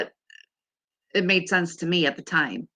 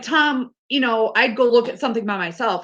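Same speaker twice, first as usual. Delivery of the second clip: ordinary delivery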